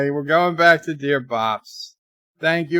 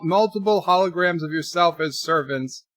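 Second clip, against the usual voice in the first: normal talking voice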